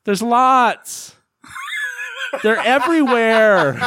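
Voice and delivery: high, loud breathless answer; hammed-up high panicked reply; mock yell. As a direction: shout it with laughter breaking through. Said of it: "And she says".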